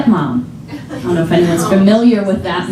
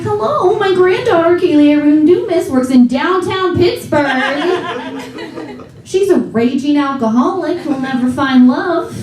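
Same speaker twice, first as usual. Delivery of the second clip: grandma radio caller; 7 semitones higher than usual. Retold or imitated. Comedian voicing grandma calling a radio prayer line, high and pleading.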